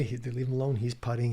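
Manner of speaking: ordinary talk, nothing marked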